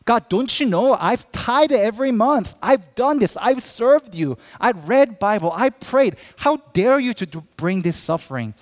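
bitter complaint at God. Retold or imitated. Pastor acts a sufferer railing at God, loud and bitter. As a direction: hurl the grievances up at God. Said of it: "You will be bitter".

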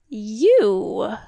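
scornful, drawn-out demon question; mocking creature. A narrator's reading. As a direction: toss it back in mockery. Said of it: "the creature laughed".

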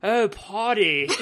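slow, hammed-up snooty British; mock British accent, on the edge of laughing; pretentious British accent. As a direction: draw it out like a pompous Brit. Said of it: "sounds like some pretentious British person".

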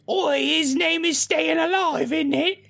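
put-on squeaky Cockney kid; British accent. Hacker kid voiced, Oi.

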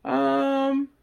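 high-pitched, drawn-out parental doubt; mock uneasy parents, slow and high; hesitant parents. Parents reacting to a shocking kids' movie, hesitant mock concern.

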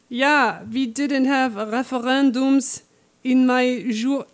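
put-on slow, drawn-out Lichtenberger accent; attempted Lichtenberger accent. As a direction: try a grandma's made-up Lichtenberger accent, slowly. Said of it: "Should I do a Lichtenberger accent?".

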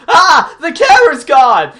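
high, loud cartoon-kid shriek of panic; mock panicked yell. Mocking the cartoon kid's line in a loud panicked shriek. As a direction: yell it like a dumb kid losing it. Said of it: "Johnny goes".

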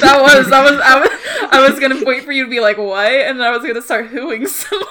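loud, helpless laughter breaking up the words; laughing explanation. Real, not acted. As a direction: stumble through it while cracking up. GM cracks up explaining joke.

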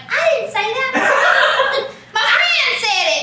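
high, loud, slow and giggly; mock her giggling denial of saying it. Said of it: "was like".

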